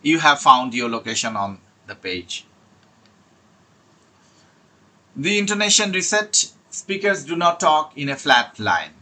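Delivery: slow, deliberate reading of a study tip; slow slide reading. Acted. Teacher reads the tip to IELTS students, slowly. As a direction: read it out word by word like a slide.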